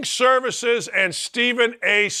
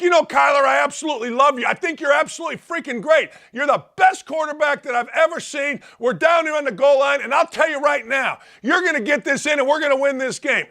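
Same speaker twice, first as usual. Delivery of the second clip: mock yelling back; 6 semitones higher than usual. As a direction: shout compliments back at him. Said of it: "I'm yelling back".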